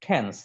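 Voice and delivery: "টেন্স" with a breathy ঠ onset; aspirated T. Shown as a demonstration. Teacher repeating the correct word for students, "টেন্স" with aspirated ঠ. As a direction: begin "টেন্স" with ঠ, puffing air after the T. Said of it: "ঠর উচ্চারণ হবে".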